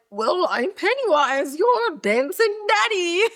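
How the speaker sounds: mock-sinister dancing clown, cackling at the end; Pennywise. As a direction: play the killer clown, pitch climbing into laughter. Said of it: "And he's like".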